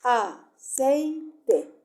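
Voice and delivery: "aceite" in three loud beats, a... cei... te; split into syllables. Shown as a demonstration. Teacher dividing a word for pupils, "aceite" syllable by syllable. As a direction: separate each syllable of "aceite" firmly and slowly. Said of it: "lo separo en sílaba".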